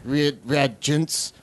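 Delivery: put-on Clouseau accent. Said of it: "All right, Clouseau".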